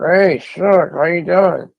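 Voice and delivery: high, loud, forced through clenched teeth; through his teeth. Retold or imitated. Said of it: "Like through his teeth".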